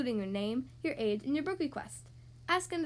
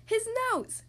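storybook shout; 11 semitones higher than usual. A narrator's reading. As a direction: cry it out high like an excited storybook character. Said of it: "Mister Happy shouted".

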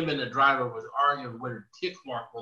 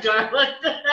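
high words breaking into laughter; real laughing; 9 semitones higher, 4 dB louder than usual. Real, not acted. Storyteller repeating the liar's line to friends, laughing. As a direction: get the quote out while cracking up. Laughs retelling the brazen lie.

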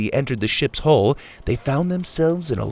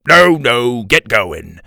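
brusque dismissal; 8 dB louder, slower than usual. A narrator's reading. Big man waves them on.

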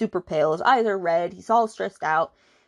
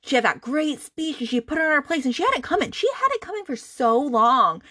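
higher-pitched, indignant rant; getting mad; 7 semitones higher than usual. Real, not acted. Reviewer venting about the sister's deserved telling-off, getting mad. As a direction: build real exasperation in a raised pitch. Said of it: "this is where I get mad".

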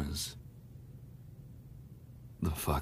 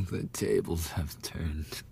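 gloating whisper; 6 dB quieter than usual. Acted. Bully whispers to the beaten victim, gloating. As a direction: savour the win under your breath.

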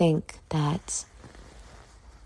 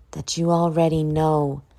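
ordinary talk

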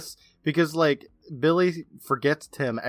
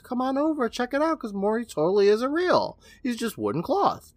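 high-pitched retold taunt; mock dismissive insisting; 11 semitones higher than usual. Retelling Billy opening the case, mock dismissive. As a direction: wave it away in a raised pitch.

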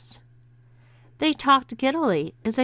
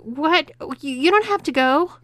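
high, quick stammering plea; startled protest; 8 semitones higher, 5 dB louder than usual. A narrator's reading. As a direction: blurt it out, louder and higher. Startled husband protests wives leaving.

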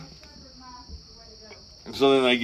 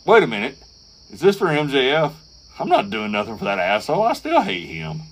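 mock reluctant Punk; 7 semitones higher than usual. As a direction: act out Punk refusing, then caving for money. Acting out Punk reluctantly agreeing.

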